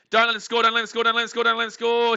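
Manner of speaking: loud repeated urging; will the runner not to score; anxious pleading. Real, not acted. Fan begging the screen, loud and tense.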